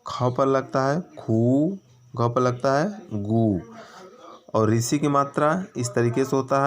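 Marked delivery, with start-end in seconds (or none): 0.1-3.6 slow teaching read with long ऊ; slow, drawn-out vowels. Shown as a demonstration. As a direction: stretch खू and गू slowly. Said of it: "थोड़ा इसमें समय ज़्यादा लगता है".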